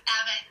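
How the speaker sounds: JEG VET squeezed into a loud "e-vett"; Bergen dialect pronunciation. Shown as a demonstration. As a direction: run "Jeg vet" together, Bergen-style. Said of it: "Und dann sagt man aber".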